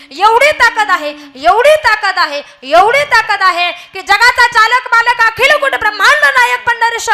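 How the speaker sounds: rousing kirtan preaching, loud and high; fervent preaching. Acted. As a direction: build to a fervent peak. Preaching at full force.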